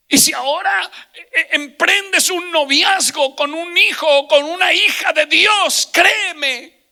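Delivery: loud, high, impassioned sermon shout; full-force preaching. Real, not acted. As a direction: drive each phrase at the congregation, stretched and shouted. (prédica a gritos, aguda y vehemente)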